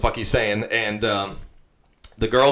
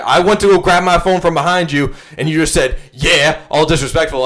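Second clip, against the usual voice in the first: higher, louder imitation of an offended drunk; drunk complaint; 6 semitones higher, 6 dB louder than usual. Drunk sister explaining her grievance, higher and louder. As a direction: retell her grievance, hammed up. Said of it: "She's like".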